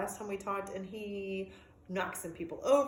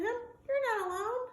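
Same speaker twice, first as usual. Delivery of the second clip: tender reassurance; 12 semitones higher, slower than usual. Acted. Dog comforts caged bird.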